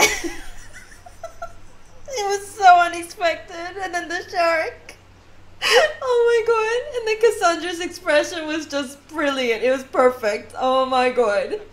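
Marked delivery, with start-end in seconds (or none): 0.0-9.6 words breaking up with laughter; still laughing. Real, not acted. Player recalls the shark scene, still laughing tearfully. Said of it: "literally crying, um, from all the laughter".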